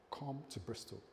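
soft, hushed command from God; a quiet summons, barely above a murmur; God's quiet call. Retold or imitated. God calling him again.

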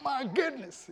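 high-pitched demo of excitement; high, acted amazement; excited exclamation. Shown as a demonstration. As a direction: exclaim it as if thrilled. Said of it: "an exclamation for excitement".

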